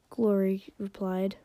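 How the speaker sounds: ordinary delivery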